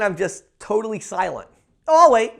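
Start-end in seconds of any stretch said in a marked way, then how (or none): 1.9-2.3 quick, higher and louder chirp; real quick. Shown as a demonstration. Salesman to gatekeeper, quick and upbeat. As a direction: snap it out brightly in half a second. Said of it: "I'm saying that so fast".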